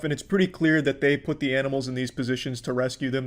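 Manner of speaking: normal talking voice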